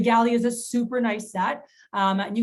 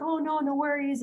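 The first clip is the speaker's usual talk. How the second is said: higher-pitched acted no-problem tone; retold breezy reassurance; 7 semitones higher than usual. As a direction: imitate letting a customer off easily. Retold reassurance to reluctant customers.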